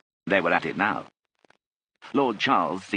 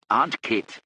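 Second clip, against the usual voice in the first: higher, urgent interjection; hasty interruption; 4 semitones higher, 3 dB louder than usual. A narrator's reading. Lord Charles stops his aunt, hasty interruption. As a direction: cut in hastily on the aunt. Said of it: "said Lord Charles hastily".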